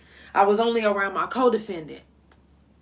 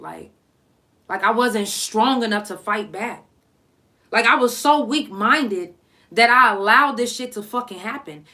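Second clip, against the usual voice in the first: normal talking voice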